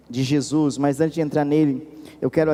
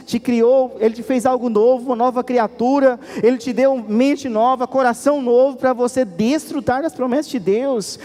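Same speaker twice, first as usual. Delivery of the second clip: full-force preaching; 6 semitones higher than usual. Acted. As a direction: preach it hard, calling the church to stop.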